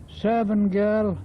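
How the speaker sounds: normal talking voice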